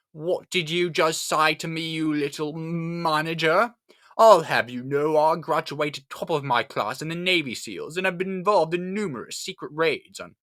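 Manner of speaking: high, slow, self-important tirade; indignant tough-guy detective. Acted. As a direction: puff up into an over-the-top boastful threat. Archibald's threatening monologue.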